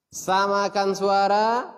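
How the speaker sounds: high, loud, slow call; call and response cue. Shown as a demonstration. Teacher calling the class to echo in unison. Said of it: "Kalau saya mengatakan samakan suara".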